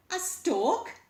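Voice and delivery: sharp loud skeleton answer; loud retort. A narrator's reading. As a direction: spit out the word. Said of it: "he replied".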